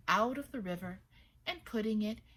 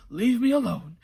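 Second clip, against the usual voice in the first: louder voice of the yam; lightly voiced yam; talking yam; 4 semitones higher, 12 dB louder than usual. A narrator's reading. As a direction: let the yam protest. Talking yam's voice.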